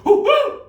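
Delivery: very high, loud monkey whoop. Shown as a demonstration. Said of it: "you could be louder".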